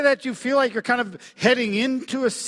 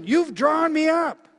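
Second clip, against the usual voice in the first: raised and slowed, full of praise; fervent scripture quote; 8 semitones higher, 5 dB louder than usual. Acted. Preacher reading Psalm thirty to congregation, fervent. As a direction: lift the verse up with awe.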